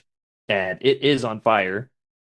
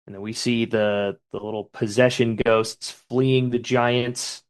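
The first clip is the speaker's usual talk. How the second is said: usual speaking voice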